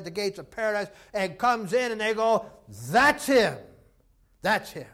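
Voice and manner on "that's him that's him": loud, emphatic acted cry; a burst of joyful certainty; excited recognition. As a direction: announce the long-awaited arrival. Waiting saints recognize the Lord.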